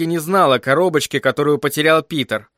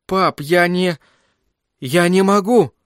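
higher-pitched, halting protest; distressed plea; 5 semitones higher than usual. A narrator's reading. Son begging his father not to send him, distressed plea. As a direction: plead haltingly with rising pitch.

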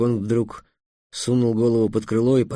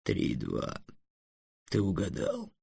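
dry dragon; 2 semitones lower, 8 dB quieter than usual. A narrator's reading. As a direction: concede it dryly and quietly. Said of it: "сухо произнёс".

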